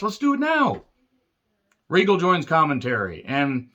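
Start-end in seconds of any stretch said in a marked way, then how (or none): 0.0-0.8 much higher, eager yelp; mock eager shout. Reviewer quoting Bryan accepting the match, high and eager. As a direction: blurt it like an eager kid.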